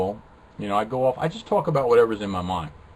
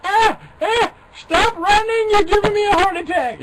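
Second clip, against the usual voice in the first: much higher, louder panicked cry; startled shriek; 7 dB louder than usual. Real, not acted. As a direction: yell at the animal as it bolts. Shrieking at a running pet.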